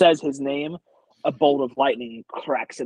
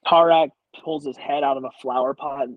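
ordinary delivery

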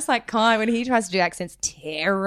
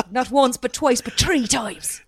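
higher, hammed-up Irish, slowing at the end; mock Irish accent; 5 semitones higher, slower than usual. Host quotes film line to guest, hammed-up Irish accent. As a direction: relish it in broad mock Irish. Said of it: "my shit Irish accent".

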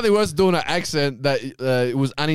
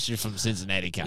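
much lower, softer mock voice; mock poser; 7 semitones lower, 10 dB quieter than usual. Mocks the girl faking an American origin. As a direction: mumble it like a poser. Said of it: "trying to pull off".

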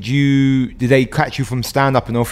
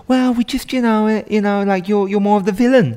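higher, put-on casting director voice; mock the industry type being tactful; hammed-up higher voice of a casting agent; casting person; 10 semitones higher than usual. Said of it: "someone in a production or casting going".

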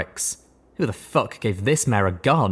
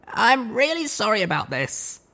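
higher mare voice, sincerely sorry; apologetic; 13 semitones higher than usual. A narrator's reading. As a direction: apologize before striking. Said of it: "I apologised".

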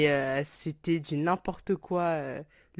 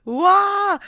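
excited; 13 semitones higher, 9 dB louder than usual. Real, not acted. Excited return after long break.

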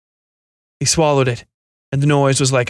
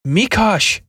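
Mikosh said higher, more insistent; insistent repeat; 7 semitones higher than usual. A narrator's reading. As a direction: call Mikosh again to snap him out. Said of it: "she repeated".

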